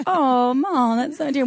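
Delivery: put-on Wisconsin accent. Daughter replying to her mom, playful Wisconsin accent. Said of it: "get the accent again".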